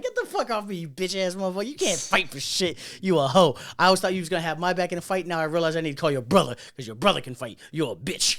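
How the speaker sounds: loud, playful trash-talk; mock scolding rant. Trash-talking the coworker at the bar, loud mock scold. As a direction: roast him loudly, half joking. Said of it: "I'm like".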